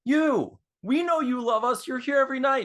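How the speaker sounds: high, loud mock stage shout; singer calling out fan. Lead singer singles out a fan in the crowd, high and loud. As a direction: call out to one fan from the stage. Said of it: "calls her out".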